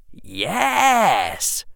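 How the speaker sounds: put-on high, drawn-out boyish yes; eager pupil's high-pitched yes; eager young Harry. Harry answering Hermione about the lessons, eager.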